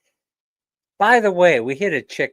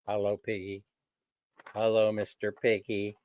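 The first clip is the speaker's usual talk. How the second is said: put-on 1 soft baby talk; 5 semitones lower, 8 dB quieter than usual. Greeting the piggy bank.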